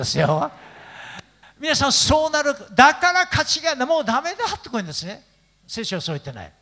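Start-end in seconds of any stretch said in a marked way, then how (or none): none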